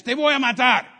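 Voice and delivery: loud, high imitation of a shouting gunman; screamed Spanish threat. Gunman threatening the driver in Spanish, screamed. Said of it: "Only in Spanish".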